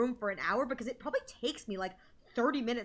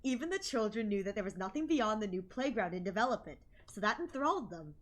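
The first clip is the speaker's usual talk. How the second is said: softer, even story reading; story narration; 2 semitones lower, 6 dB quieter than usual. A narrator's reading. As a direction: read it like a novel. Reads the game narration.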